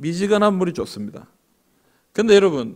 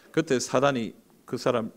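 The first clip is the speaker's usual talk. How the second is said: ordinary delivery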